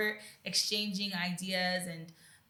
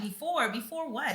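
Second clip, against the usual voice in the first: mock puzzled echo; 6 semitones higher than usual. Mocking friend's repeated "before.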